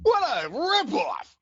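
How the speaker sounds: high, slow exaggerated outcry; mock outrage. Real, not acted. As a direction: cry out in joking outrage. Joking outrage, laughing.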